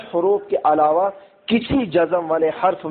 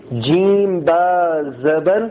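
slow spelling drill; much slower than usual. Shown as a demonstration. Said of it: "spelling the syllable".

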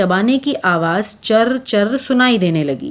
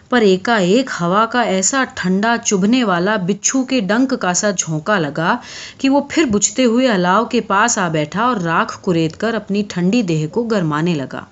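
ordinary delivery

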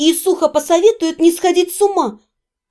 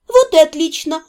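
plain reading voice

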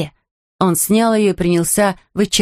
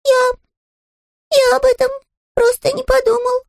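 bear cub voice. Acted. Bear speaking.

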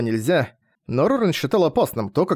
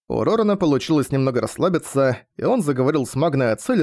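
plain reading voice

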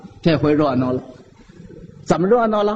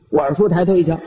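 ordinary talk

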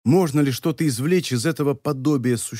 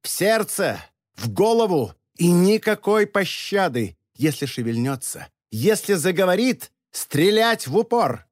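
higher, furious orders; grinding teeth in rage; 5 semitones higher than usual. A narrator's reading. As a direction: bark the orders through clenched teeth. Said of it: "Вебер скрежетал зубами".

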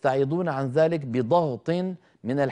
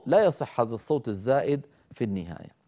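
usual speaking voice

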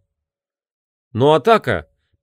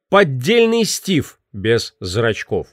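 usual speaking voice

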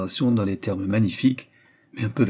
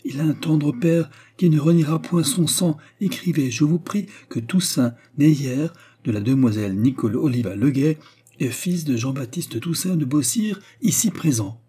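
passionate, raised voice claiming his son; passionate outcry; 6 semitones higher than usual. A narrator's reading. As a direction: declare fatherhood with fire. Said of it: "s'écria-t-il".

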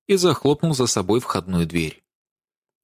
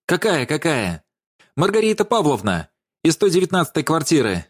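higher-pitched, mocking comeback; blurted out; 6 semitones higher than usual. A narrator's reading. Даша invents a witness on the spot, blurting it out. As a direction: throw the answer straight back, insistently. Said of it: "Выпалила она первое, что пришло в голову".

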